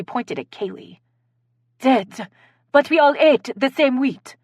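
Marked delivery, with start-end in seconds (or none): 1.8-4.4 put-on higher, louder French accent. A narrator's reading. Dinner guest reacts to poisoning news, French accent. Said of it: "responded with a French accent".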